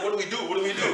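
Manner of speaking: normal talking voice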